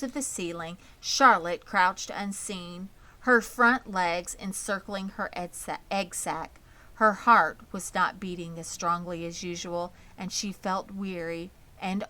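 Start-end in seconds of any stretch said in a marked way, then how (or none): none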